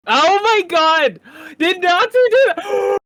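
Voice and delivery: loud, high, stretched-out oh my God; a shocked streamer yelling out her disbelief; shocked shout. Real, not acted. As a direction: gasp it out, amazed and loud. Streamer shocked by soundboard clip.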